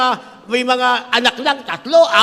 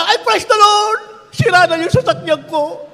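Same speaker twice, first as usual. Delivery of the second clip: high, slow, sarcastic praise; sarcastic mock praise; 6 semitones higher than usual. Pastor mocking a car owner with fake delight. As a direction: ham up fake joy at a wrecked car. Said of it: "Sasabihin nyo".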